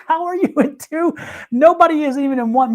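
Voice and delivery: high-pitched retold disbelief; incredulous. Reader recalling her thoughts on two helicopter crashes, incredulous.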